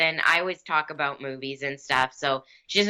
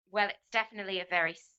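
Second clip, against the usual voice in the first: British accent answer; 4 semitones higher than usual. Shown as a demonstration. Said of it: "you can just answer me in a British accent".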